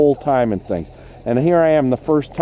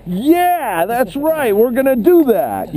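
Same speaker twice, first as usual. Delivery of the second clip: much higher, bubbly encouraging voice; upbeat positive teacher; 9 semitones higher, slower than usual. Shown as a demonstration. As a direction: cheer the kids along, all yes. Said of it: "stay real positive".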